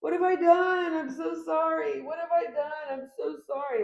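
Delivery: high, loud, pleading mock remorse; frantic apologizing ex. A reader acting out the ex begging forgiveness, high pleading. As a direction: wail the apology like a guilty ex begging. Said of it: "I feel like they're always like".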